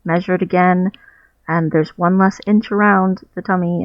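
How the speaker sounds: ordinary delivery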